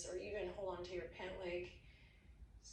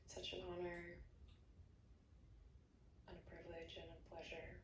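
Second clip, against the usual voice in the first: soft guided meditation; 8 dB quieter than usual. Acted. Yoga teacher ending class, hushed meditation voice. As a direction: settle them gently into rest. Closing relaxation guidance.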